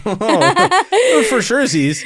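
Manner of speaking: high, laughing reply. Real, not acted. Answering the host about regrets, laughing. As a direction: burst out laughing as you answer.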